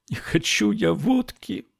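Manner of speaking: high sobbing refusal. A narrator's reading. Girl sobbing as gendarme offers vodka. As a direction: sob it, pushing the bottle away. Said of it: "всхлипнула девушка".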